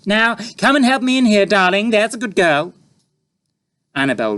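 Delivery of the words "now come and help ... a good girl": lightly scolding; breezily. A narrator's reading. Mother breezy to daughter.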